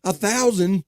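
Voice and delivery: high, incredulous question; real disbelief. Real, not acted. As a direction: repeat it as if you can't believe it. Reacting to a huge dose.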